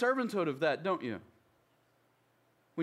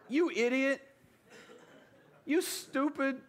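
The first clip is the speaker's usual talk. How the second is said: higher, teasing mock jab; mock insult; 6 semitones higher than usual. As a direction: toss the insult up high, half-joking. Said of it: "a Southern way of saying".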